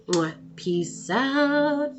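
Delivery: blown kiss. Real, not acted. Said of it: "blowing a kiss goodbye".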